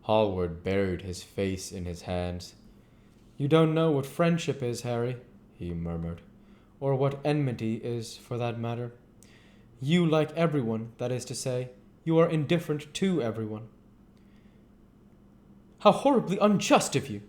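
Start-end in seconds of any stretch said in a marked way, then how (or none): 3.4-13.7 lightly voiced earnest painter, hurt; a hint of a wounded artist pleading with his friend; Basil the painter. 15.8-17.2 lightly voiced Lord Henry crying out in mock offence; a playful, higher protest from the dandy; mock outcry. Lord Henry protests to Basil in mock outrage. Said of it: "cried Lord Henry".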